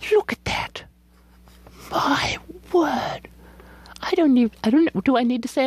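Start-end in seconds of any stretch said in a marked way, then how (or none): 0.1-3.3 hushed higher whisper; whispered amazement; awed whisper. Real, not acted. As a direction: breathe it out in wonder. Admiring paper.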